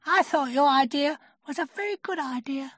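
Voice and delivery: very high, small creature voice; Piglet. A narrator's reading. Piglet reassuring Pooh about his idea, high little voice. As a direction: pitch it right up, little and squeaky.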